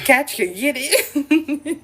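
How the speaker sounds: slow, laughing through it; giggly. Real, not acted. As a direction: purr it with a giggle.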